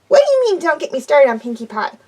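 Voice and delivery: high, bouncy pony voice; Pinkie's voice. A narrator's reading. Pinkie asking Dash what she means, high and bouncy. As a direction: squeak the question out.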